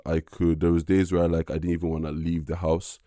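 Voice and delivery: usual speaking voice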